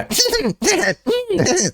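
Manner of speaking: high giggles in place of words; imitated giggle. Tram stranger answering a question, imitated high giggling. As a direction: answer with mimicked giddy chuckles. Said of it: "And he would go".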